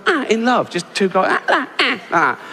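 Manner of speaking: high, excited chimp hooting; chimp hoots. Pretend. Chimp hoots while walking.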